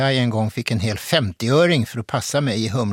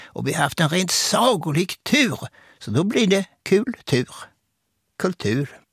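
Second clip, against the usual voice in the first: higher-pitched impression, rising on the key words; Hasse Alfredson; 7 semitones higher than usual. Comedian explaining his luck in culture, high-voiced impression. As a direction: deliver the wordplay in a higher put-on voice. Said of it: "så svarade han".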